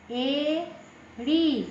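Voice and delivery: एड़ी stretched out very slowly; slow model word एड़ी for pupils; very slow demo. Shown as a demonstration. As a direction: draw out एड़ी syllable by syllable. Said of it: "यानी उच्चारण देखने के लिए".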